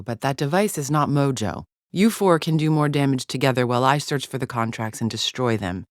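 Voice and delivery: normal talking voice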